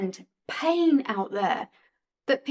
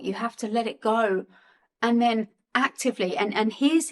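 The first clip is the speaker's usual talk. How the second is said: ordinary talk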